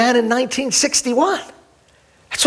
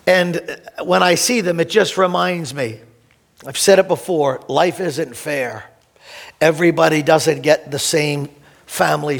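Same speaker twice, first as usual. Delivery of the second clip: tender memory; 6 semitones lower than usual. Real, not acted. Pastor to congregation about his family, tender. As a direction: speak gently of family.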